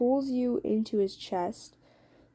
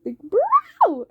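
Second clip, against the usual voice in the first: much higher and louder squeal of delight; excited squeal; 11 dB louder than usual. Real, not acted. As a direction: shriek it like a thrilled fan. Teller reacting to hot moment.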